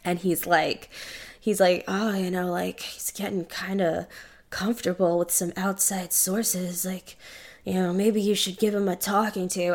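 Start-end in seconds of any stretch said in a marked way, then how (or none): 1.9-9.7 hushed, insinuating imitation of a creep; creepy weirdo. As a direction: play the scummy meddler, softly. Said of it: "just being, like, a weirdo".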